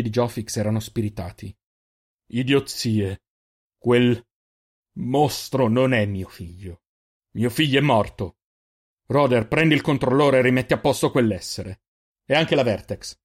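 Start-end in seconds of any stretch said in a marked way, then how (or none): 2.3-13.2 a father's hard, louder orders; cold anger. Acted. Father refusing his son, cold hard anger. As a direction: spit out the rejection, slow and hard.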